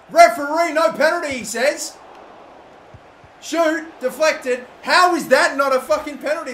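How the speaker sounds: high, loud angry disbelief; outraged protest. Real, not acted. Gamer protesting a denied penalty, loud and high. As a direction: rage at the referee's call.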